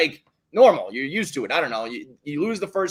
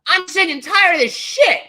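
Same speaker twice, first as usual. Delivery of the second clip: high mock-angry manager voice; squeaky put-on rant; imitating Boone; 13 semitones higher, 6 dB louder than usual. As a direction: parody of a fed-up manager. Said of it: "he's like".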